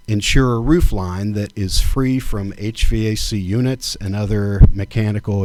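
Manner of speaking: ordinary delivery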